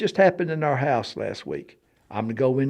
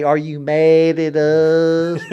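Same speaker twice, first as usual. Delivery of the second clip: slow, loud, drawn-out call to a spirit; mock spirit-calling; 3 semitones higher, 7 dB louder, much slower than usual. Storyteller mimics addressing the spirit, slow loud call. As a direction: call out slowly to the spirits, hammed up. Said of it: "you come and say".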